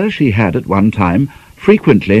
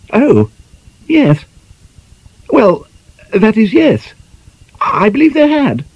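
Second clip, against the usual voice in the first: flustered lady. A narrator's reading. Lady caught off guard by Chatford, high and flustered. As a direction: stammer it out like a flustered spinster.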